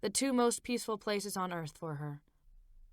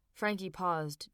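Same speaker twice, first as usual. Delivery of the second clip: plain reading voice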